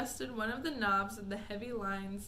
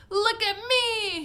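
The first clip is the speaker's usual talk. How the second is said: high, loud, slow gleeful yell; shouting; 12 semitones higher, 11 dB louder, slower than usual. Acted. Mike yells to everyone while racing to the camera. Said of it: "he shouted as he ran".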